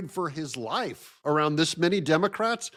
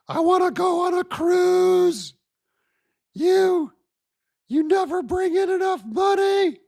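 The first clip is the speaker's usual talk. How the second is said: nagging wife. Pretend. Host plays the protester as his nagging wife, hammed-up high squeal.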